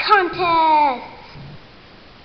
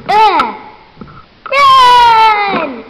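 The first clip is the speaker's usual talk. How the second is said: loud, high panicked cries; frantic shouts; 5 semitones higher, 9 dB louder, much slower than usual. Acted. Characters fleeing in chaos, loud panicked cries.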